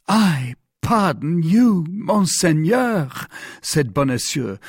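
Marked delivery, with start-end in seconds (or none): 0.1-3.1 slow, high-pitched protest; hesitant disbelief. A narrator's reading. Mercer offered a purse by the Cardinal, hesitant disbelief. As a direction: draw it out, overwhelmed by the request. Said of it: "hesitating to take the purse".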